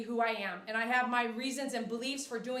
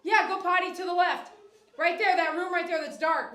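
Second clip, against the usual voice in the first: loud, higher directions across the room; calling out; 5 semitones higher, 6 dB louder than usual. Real, not acted. Directing child to bathroom.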